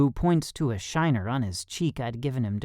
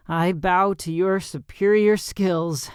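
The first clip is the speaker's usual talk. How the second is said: slow, higher winded concession; gasping; 6 semitones higher, 4 dB louder, slower than usual. A narrator's reading. Winded fighter conceding to the trainer, gasping. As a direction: play out of breath, drawing the words out. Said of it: "I gasp".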